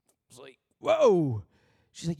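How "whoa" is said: startled whoa, louder; taken aback. Retold or imitated. Husband reacts to his wife's words, surprised. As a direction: caught off guard.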